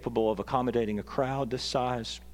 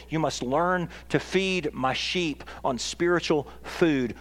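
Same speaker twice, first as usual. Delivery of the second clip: raised, insistent voice of a teacher charging followers; pointed charge; 6 semitones higher, 4 dB louder than usual. Retold or imitated. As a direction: press each clause home, higher and forceful. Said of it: "puts his index finger in the collective chest".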